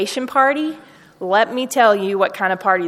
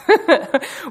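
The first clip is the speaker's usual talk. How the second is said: bright laugh; 8 semitones higher than usual. Real, not acted. Laughs while telling of the dance.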